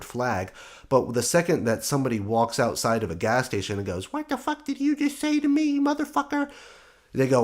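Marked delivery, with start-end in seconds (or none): 4.1-6.5 mocking Karen screech, pitched much higher; screechy Karen. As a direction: squeal it like a raging Karen outside a gas station. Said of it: "these screechy bitches".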